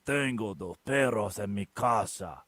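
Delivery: put-on slow Spanish with a thick Southern accent; Spanish read slowly in a thick Southern accent. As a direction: drawl the Spanish like an Arkansas professor. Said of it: "he had this thick Southern accent".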